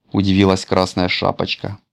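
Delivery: ordinary delivery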